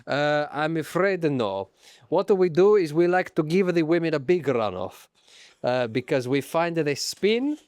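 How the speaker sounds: mock Italian official's accent; put-on Italian accent. Official explaining the big runoff, Italian accent. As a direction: refuse politely in an Italian accent. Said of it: "if you do an accent".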